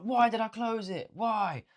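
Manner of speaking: high, whining self-reproach; hammed-up frustrated moaning; mock whiny regret.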